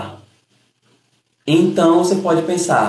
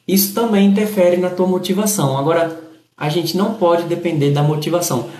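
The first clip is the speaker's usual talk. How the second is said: ordinary delivery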